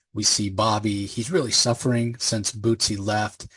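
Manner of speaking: normal talking voice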